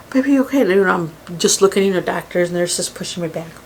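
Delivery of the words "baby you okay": higher, louder worried call; urgent check on a child; worried mother. Retold or imitated. Mother rushing to her sons, worried.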